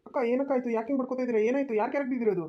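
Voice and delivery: high, urgent questions from a passerby; alarmed questioning. Acted. Passerby rushes up to screaming woman.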